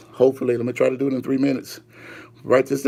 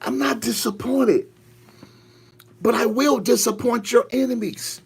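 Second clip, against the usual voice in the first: high, ringing pronouncement; lifted pulpit proclamation; prophetic preaching; 8 semitones higher than usual. Acted. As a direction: declare God's verdict with pauses. Speaks as God to believers.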